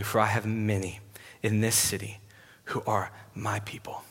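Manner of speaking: soft, low voice with long pauses; hushed, tender voice of God; gentle reassurance. Acted. God reassuring Paul in a vision.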